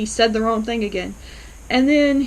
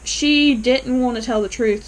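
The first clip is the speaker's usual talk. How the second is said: normal talking voice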